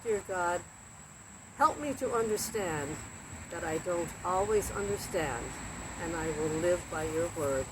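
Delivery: soft, hushed closing prayer. Real, not acted. Host ends story time with a soft prayer.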